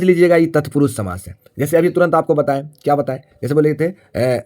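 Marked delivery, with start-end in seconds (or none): none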